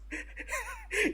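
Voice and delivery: high, squeaky laugh bursting out; real burst of laughter. Real, not acted. Reviewer cracking up at his joke, high laugh. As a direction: laugh at your own bit.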